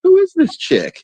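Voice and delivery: who on earth is she, way up high; incredulous. Retold or imitated. Incredulous at Larvita's name-dropping.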